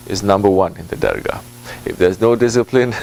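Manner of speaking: usual speaking voice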